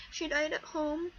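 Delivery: ordinary talk, nothing marked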